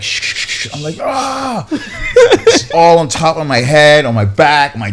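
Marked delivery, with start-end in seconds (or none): 0.0-0.7 long hissing spray sound; unvoiced shhh bursts; imitated spray noise. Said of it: "So she's like". 1.0-1.7 high-pitched yelp at ice-cold water; imitated cry of cold shock; freezing-shock yelp. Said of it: "it was absolutely brutal".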